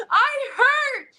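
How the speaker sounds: very high, loud mock wail; comic anguished outburst; pained wail. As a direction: cry out in hammed-up agony. Acted reply to fans, in pain.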